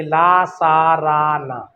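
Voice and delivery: "ला सा रा ना" spelled out very slowly, one syllable at a time; each of "ला सा रा ना" drawn out so students can write it down; slow dictation. Shown as a demonstration. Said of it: "note करिए".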